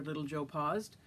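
plain reading voice

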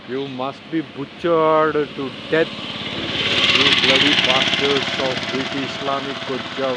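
building into a loud, furious curse; real angry outburst. Real, not acted. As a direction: let the rage swell into a shout. Cursing opponents in an angry rant.

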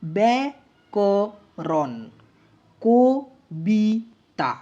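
ব্যাকরণ and কবিতা very slowly, each in three pieces; syllable by syllable. Shown as a demonstration. Teacher has students count three units in ব্যাকরণ, কবিতা, syllable by syllable. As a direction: sound out ব্যা, ক, রণ and ক, বি, তা with gaps. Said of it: "তিনটি দল পাচ্ছি দেখো".

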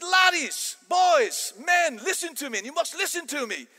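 high, loud, fiery Scottish professor; Scottish lecturer roaring at his class; Scottish accent. Acted. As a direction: shout it in a fired-up Scottish accent. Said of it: "American accent to my Scottish accent".

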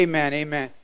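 usual speaking voice

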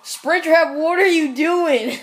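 loud, high call of a character's name; exasperated shout. Acted. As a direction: yell across at the one who won't move. Calling to a character that won't play.

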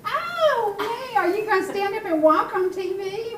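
high, loud delighted exclaim; excited. Real, not acted. Grandma to baby about walking, loud high excitement. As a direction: squeal it out with delight.

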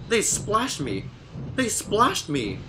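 hammed-up outraged engine, dragging each word; angry hiss. A narrator's reading. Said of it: "Bert hissed".

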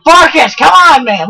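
loud, high protest; exasperated yell. Real, not acted. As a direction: call him off, loudly. Pleading with attacking companion.

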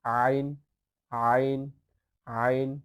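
আইন squeezed from the middle of the throat; ain from mid-throat. Shown as a demonstration. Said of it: "হলকের মধ্যখান হইতে উচ্চারণ করবেন".

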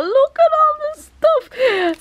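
high, slow squeal of delight; excited squeal. Real, not acted. Vlogger gazing at her haul, delighted squeal. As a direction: stretch it out, marvelling.

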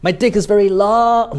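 long stretched high in a joke demo; joking elongation. Shown as a demonstration. Teacher joking to students, long high and stretched. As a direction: raise the line and draw out long. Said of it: "you actually elongate that word".

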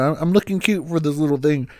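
ordinary delivery

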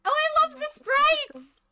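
excited gushing. Real, not acted. Gushing over sprites.

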